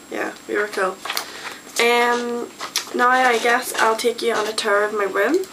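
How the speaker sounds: ordinary delivery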